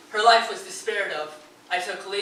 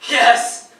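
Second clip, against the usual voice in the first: sudden loud high yes; anguished outcry; 6 semitones higher, 13 dB louder than usual. Acted. Grieving husband confirming the cause of her death, sudden shout. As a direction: burst out with it, all at once. Narrator bursts out in grief.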